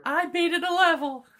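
very high, loud mock squeal; terrible Amanda impression. Co-host mocking Amanda playing games, terrible impression. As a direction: shout it in a silly high-pitched voice. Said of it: "You do a terrible Amanda impression".

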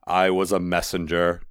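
plain reading voice

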